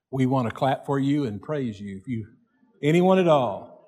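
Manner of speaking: ordinary talk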